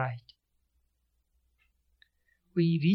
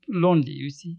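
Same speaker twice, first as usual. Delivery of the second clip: ordinary delivery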